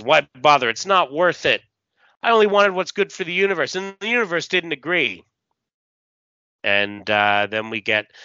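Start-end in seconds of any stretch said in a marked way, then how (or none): 0.0-5.2 higher-pitched, hurt comic-book hero; Doc. Acted. Doc defends himself to the one confronting him, higher-pitched acted protest. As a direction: read it as a wounded self-justification. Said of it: "Doc says".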